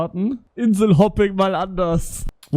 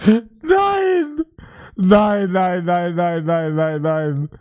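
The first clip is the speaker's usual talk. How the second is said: stunned, laughing nein; much slower than usual. Real, not acted. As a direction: cry out in disbelief, half laughing. Streamer shocked at flight news.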